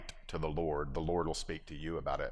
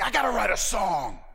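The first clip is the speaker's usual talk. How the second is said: sudden inspiration; 13 semitones higher, 7 dB louder than usual. Retold or imitated. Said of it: "on this moment he's like".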